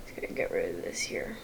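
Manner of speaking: low whisper to self. Real, not acted. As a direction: mutter it in a whisper. Muttering while editing.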